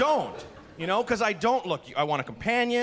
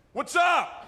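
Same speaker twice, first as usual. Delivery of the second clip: high, loud shouted what's up; yelled greeting; 10 semitones higher, 3 dB louder than usual. Retold or imitated. Guy on the bus yelling to a woman, high and loud. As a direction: call it out across the whole bus.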